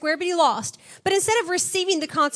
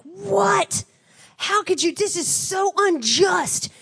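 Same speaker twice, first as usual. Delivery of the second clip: higher, very slow, whiny indignant voice; mock outraged Samson; 5 semitones higher, much slower than usual. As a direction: ham up a self-pitying tantrum. Samson acts wronged, mocked.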